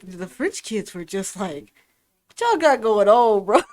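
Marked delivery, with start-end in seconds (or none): 2.3-3.6 loud imitation of baffled onlookers; mock disbelief. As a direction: call them out, hammed-up. Imitates judgmental French kids.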